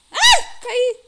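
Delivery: high shrill yelp; startled shriek. Real, not acted. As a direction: shriek as you fall. Falls in the game.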